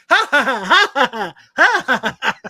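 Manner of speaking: high, loud cartoon villain cackle; Mandark laugh. Imitating Dexter's arch enemy, loud villain laugh. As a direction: hammer out staccato villain ha-has. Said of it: "his arch enemy goes".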